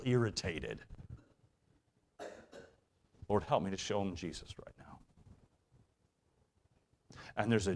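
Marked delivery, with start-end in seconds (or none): 3.3-5.0 hushed, breathy prayer under the breath; whispered prayer. Pretend. Parent whispering a prayer for patience in a tense moment, hushed. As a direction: pray it in a whisper to yourself.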